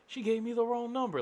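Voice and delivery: high, loud complaint to a friend nearby; caller complaining. Retold or imitated. Caller talking to background.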